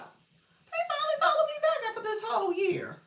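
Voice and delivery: very high, giddy squeal; thrilled falsetto, half laughing; delighted reaction. Retold or imitated. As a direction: squeal it with laughter bubbling through. Acting out delighted reaction to follow.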